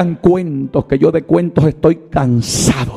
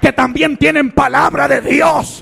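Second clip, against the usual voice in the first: higher and higher, ending in shouted promises; rousing preaching; 10 semitones higher than usual. Acted. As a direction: lift the church with God's promises. Preaching climax. (predicación exaltada que termina en gritos)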